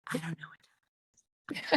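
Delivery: whispered, unvoiced; whispered aside. Speaker admitting she lost track of time, whispering. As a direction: breathe it out as a sheepish aside.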